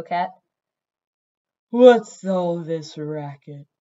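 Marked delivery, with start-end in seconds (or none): 1.7-3.7 slow, loud, low grumbling cat; low sleepy rumble. A narrator's reading. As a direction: rumble it like a cat woken from a nap. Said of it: "rumbled a low, sleepy cat voice".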